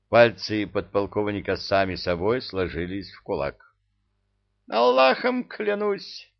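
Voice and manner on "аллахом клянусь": high, slow frantic swearing; high frantic oath. Acted. Emir swears in fright.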